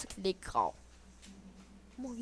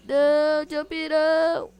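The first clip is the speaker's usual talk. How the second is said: loud, higher, slow shout; being chased; 5 semitones higher, 13 dB louder, much slower than usual. Real, not acted. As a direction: yell it out in dismay. Hit by the jump attack.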